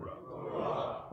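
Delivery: far-off group oorah; a crowd calling back; distant crowd shout. Real, not acted. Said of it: "Say "oorah" for me".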